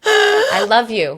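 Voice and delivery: high, slow, laughing I love you. Real, not acted. Reacting to her friend's story, slow laughing gush.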